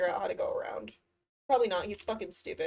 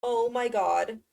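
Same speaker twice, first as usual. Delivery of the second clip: ordinary talk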